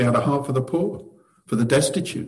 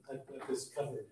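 barely audible; 24 dB quieter than usual. Retold or imitated. Quiet echo of a comforting cliché.

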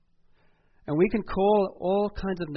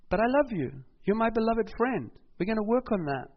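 higher, louder God turning affectionate after a rebuke; raised voice, now fond and reassuring; God loving; 8 semitones higher, 4 dB louder than usual. Retold or imitated. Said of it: "And he's gonna say".

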